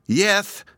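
"Yeth" lisped high and loud, s as th; little girl lisp on "Yeth". A narrator's reading. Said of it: "lisped Hepzibah".

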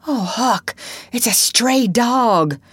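high, tense voice, stretched out in dread; fearful hushed cry. A narrator's reading. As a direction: break the dreadful news to your friend. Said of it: "His whisper was hardly audible".

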